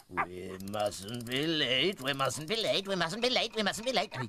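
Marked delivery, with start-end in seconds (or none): 0.1-2.0 slow, drawn-out clock voice. A narrator's reading. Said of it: "Slowly the clock began to speak again". 2.0-3.5 speeding up. A narrator's reading. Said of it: "Slowly the clock began to speak again". 3.5-4.1 higher, brisk clock voice; back up to speed. A narrator's reading. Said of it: "Slowly the clock began to speak again".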